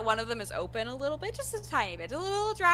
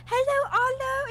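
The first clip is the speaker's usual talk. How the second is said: high, slow happy hello; excited greeting; 14 semitones higher, 5 dB louder than usual. Acted. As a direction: greet a friend with delight. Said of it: "She's like".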